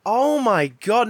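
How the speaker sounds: slow thrilled exclamation; slow excited gasp. Real, not acted. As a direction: stretch it out, delighted.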